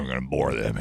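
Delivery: low, lightly put-on borer; put-on company voice. Host echoes the tunnel bit, low put-on voice. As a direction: intone it low as the mock company. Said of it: "You gotta say it in that voice".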